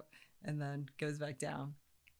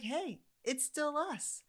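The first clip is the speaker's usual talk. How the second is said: high, slow cheerful answer; mother echoing the doctor's greeting, slow and cheerful; cheerful reply; 10 semitones higher, slower than usual. Retold or imitated. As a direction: echo the greeting playfully.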